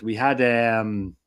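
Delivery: ordinary talk, nothing marked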